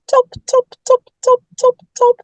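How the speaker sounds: high voiced knocking; tap-tap sounds sung high; mock door knock; knock knock noise.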